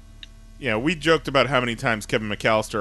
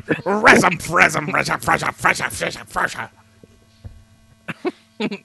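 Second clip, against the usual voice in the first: gruff cartoon cowboy sputter; sputtering Yosemite Sam grumble; Yosemite Sam impression; 4 semitones higher than usual. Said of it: "Yosemite Sam".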